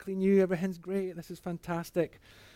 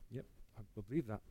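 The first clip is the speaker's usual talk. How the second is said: hushed reply; 4 semitones lower, 12 dB quieter, faster than usual. Retold or imitated.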